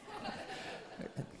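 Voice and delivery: a short, airy burst of laughter; quick soft chuckle; breathy laugh. Real, not acted. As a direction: laugh under your breath at the joke. Laughs at Peter's reply.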